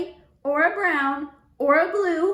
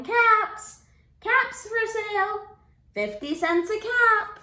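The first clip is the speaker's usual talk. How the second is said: calling out; 8 semitones higher than usual. A narrator's reading. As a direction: call out wares to the town.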